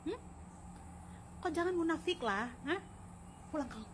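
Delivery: ordinary delivery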